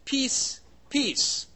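"peace", then "peace", separate emphatic beats; emphatic repetition. Shown as a demonstration. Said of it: "used to denote an emphatic, emphasis".

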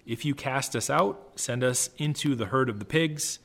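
ordinary talk, nothing marked

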